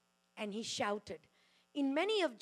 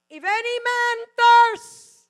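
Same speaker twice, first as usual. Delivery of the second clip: slow, very loud, high cry; shouted; 12 semitones higher, 26 dB louder, much slower than usual. Retold or imitated. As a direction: cry it out to the crowd. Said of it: "he shouted".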